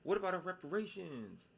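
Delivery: high, loud mocking whine; mocking quote. Mocking looters in a high, loud whine. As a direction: sneer it up high. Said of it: "turn around and be like".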